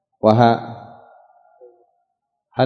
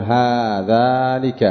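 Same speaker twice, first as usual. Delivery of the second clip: slow demonstration; much slower than usual. Shown as a demonstration. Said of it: "فتقول".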